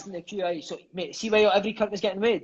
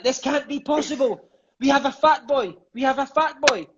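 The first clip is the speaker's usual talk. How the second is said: scolding coach; 7 semitones higher than usual. Retold or imitated. Coach quoted scolding about weight.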